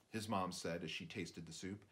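ordinary delivery, nothing marked

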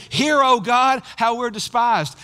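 loud, high cry to God; vulnerable plea. Acted. Nehemiah praying to God as a despised man, loud vulnerable plea. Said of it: "when you hear him say".

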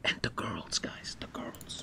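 hushed, secretive whisper. Real, not acted. Streamer confiding to his viewers about the girls, whispered. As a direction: lean in and whisper it to the chat.

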